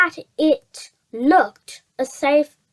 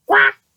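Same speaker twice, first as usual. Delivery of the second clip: a higher, louder, sharper quack; mock duck quack; 7 semitones higher, 5 dB louder than usual. Jemima quacks at the fox with a mock quack. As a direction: give a startled duck's quack.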